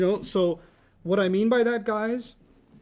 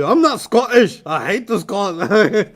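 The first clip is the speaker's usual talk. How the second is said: loud, high mock outrage in a Scottish accent; mad Scottish protest; 6 semitones higher, 8 dB louder, much slower than usual. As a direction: protest furiously, imitating an indignant Scot. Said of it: "So he was really mad at me".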